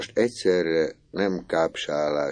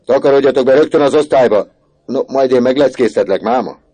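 much louder, higher, fast shouted command; furious order; 8 semitones higher, 11 dB louder, faster than usual. Acted. Teacher orders the boys to the classroom, fast furious shout. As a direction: drive them inside with rage.

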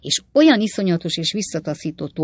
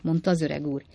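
plain reading voice

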